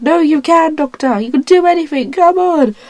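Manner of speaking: high, loud encouragement; urging. Retold or imitated. Child acting the crew urging the Doctor on. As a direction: cheer him on, come on. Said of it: "they're like".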